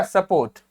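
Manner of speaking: "सपोर्ट" with a quick uh, then full o; full o sound. Shown as a demonstration. Teacher confirming the rule, "सपोर्ट" with reduced first vowel. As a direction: give the o in "सपोर्ट" its whole sound. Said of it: "ओ को पूरा pronounce किया".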